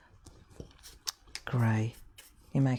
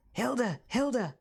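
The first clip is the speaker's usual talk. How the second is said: higher-pitched Scouse calling of a name; urgent friend; 6 semitones higher than usual. Retold or imitated. Friend suddenly turning to customer mid-demonstration, high Scouse. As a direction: imitate a friend nudging for attention, pitched up. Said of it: "she suddenly turns to me and she says".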